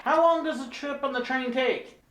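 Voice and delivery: high-pitched clueless tourist voice; dumb tourist. Acted. Tourist asking train worker about schedule, high clueless voice. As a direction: ask it high and naive. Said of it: "my dumb tourist".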